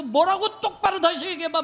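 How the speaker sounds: high, loud indignant challenge; acted hot-tempered retort; angry confrontation. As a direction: demand it like grabbing a collar. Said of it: "느헤미야가 산발락 멱살을 잡고".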